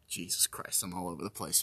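soft, exasperated mutter to self; under the breath, fed up with himself; quiet aside. Real, not acted.

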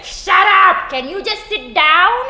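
put-on loud, high Indian accent scold; Indian teacher accent. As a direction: yell it like a strict Indian teacher. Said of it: "I try my best not to do it".

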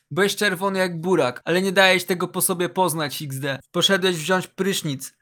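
ordinary delivery

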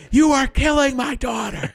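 very high melodramatic accusation; mock a parent raising hell; hammed-up outraged parent. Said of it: "act like a spoiled piece of garbage".

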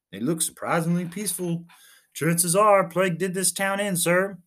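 a keen junior officer reporting brightly; eager lieutenant. Acted. Lieutenant reporting to his captain, lightly voiced eager.

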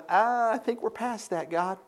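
high, very soft put-on voice; amused, doubtful Abraham. Abraham answering God's promise, amused doubt. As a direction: politely wave God off in a small voice. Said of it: "he finds this rather comical".

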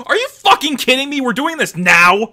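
high-pitched mock outrage, stretching the last words; exasperated squawk of a caught-out accomplice; incredulous protest. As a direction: demand it in utter disbelief. Acts out Bertolt's disbelief.

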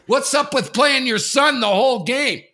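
high, loud angry challenge; loud confrontation. Retold or imitated. Dad confronts the coach in the lobby, loud and angry. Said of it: "I'm sort of loud".

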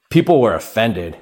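usual speaking voice

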